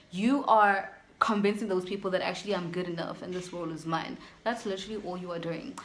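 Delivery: ordinary talk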